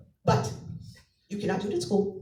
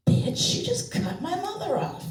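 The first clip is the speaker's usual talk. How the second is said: put-on kid swearing; 9 semitones higher than usual. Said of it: "she'd be going".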